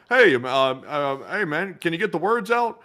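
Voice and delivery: funny-talking New York guy impression; imitated New Yorker. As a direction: stammer into a mock New York jibe. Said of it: "he talks really funny, sounds like a New Yorker".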